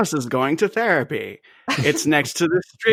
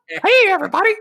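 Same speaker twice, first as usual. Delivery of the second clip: high cartoon animal; 4 dB louder than usual. Acting out the film's animals.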